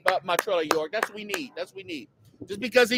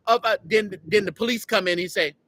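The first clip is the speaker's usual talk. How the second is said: usual speaking voice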